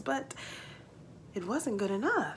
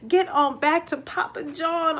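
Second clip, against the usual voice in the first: excited narration; 7 semitones higher, 4 dB louder than usual. A narrator's reading. Climax of the chase.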